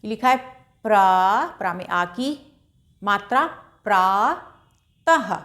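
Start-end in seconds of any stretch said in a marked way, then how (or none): 3.0-5.3 put-on teacher drawing out प्रातः for children to copy; slow reading aloud. Shown as a demonstration. Slow drawn-out प्रातः for pupils to repeat. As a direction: stretch out प्रातः slowly, syllable by syllable. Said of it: "आप लोग ज़ोर ज़ोर से बोलकर अभ्यास करिएगा".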